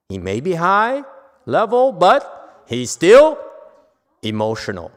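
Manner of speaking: loud, high, impassioned preaching; fervent preaching. Acted. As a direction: drive the point home at full force. Preaching the master's lesson.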